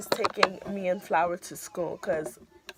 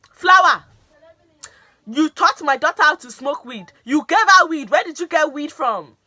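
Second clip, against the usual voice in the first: high, loud accusing dad; imitating angry dad; 8 semitones higher, 12 dB louder than usual. Dad confronting daughter's friend in the car, mock yelling. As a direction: bark it at the friend like a furious father.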